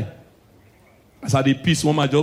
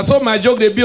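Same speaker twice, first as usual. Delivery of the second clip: usual speaking voice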